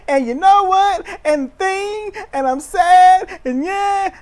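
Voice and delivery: high, loud, whiny mock voice; whiny Kevin. Mocking Kevin blaming Cody, high whiny whimper. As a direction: whine it like a sulking child.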